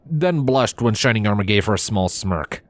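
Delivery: ordinary delivery, nothing marked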